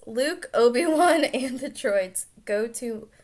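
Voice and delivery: loud restart while laughing; laughing through it. Real, not acted. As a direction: plough on through the giggles. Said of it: "oh my goodness".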